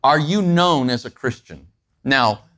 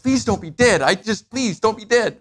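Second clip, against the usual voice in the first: higher, half-joking plea to a numb limb; mock pleading; 8 semitones higher than usual. Shaking a numb hand, a mock-pleading high voice. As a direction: beg your sleeping hand, lightly hammed-up. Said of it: "You're like".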